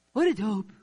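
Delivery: hammed-up scornful outburst; mock scornful jab. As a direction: spit it out like a self-insult. Mock insult at oneself.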